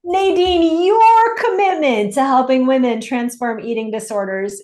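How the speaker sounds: high, loud gushing praise; gushing excitement. Real, not acted. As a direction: gush over her, thrilled.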